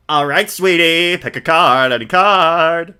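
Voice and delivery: showy, drawn-out flair; flamboyant magician. Acted. Max greeting the visitors, lightly voiced flamboyant showman. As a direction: ham up a vain showman.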